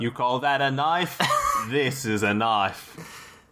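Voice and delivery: mock Australian accent, slowing heavily on the second sentence; Crocodile Dundee. Shown as a demonstration. Host quoting an Australian film in the accent game, imitated Australian accent. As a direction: put on an Australian movie-hero accent, drawing out the comeback. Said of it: "Crocodile Dundee".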